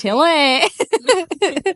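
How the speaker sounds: loud, high put-on British call of Tilly; British guy. Imitating the TikTok boss calling Tilly, mock British accent. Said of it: "and I'm like".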